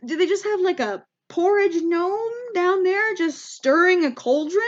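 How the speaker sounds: high-pitched, incredulous wondering; baffled question. Retold or imitated. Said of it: "I'm a little confused".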